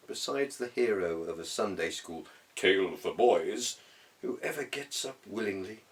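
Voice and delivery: plain reading voice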